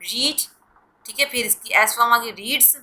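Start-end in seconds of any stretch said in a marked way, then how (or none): 0.0-0.4 रीड said like "reed", long ee; रीड with a long ee sound; present tense pronunciation. Shown as a demonstration. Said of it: "जो first form होती है वो होती है रीड". (रीड को लंबी "ई" से बोलें)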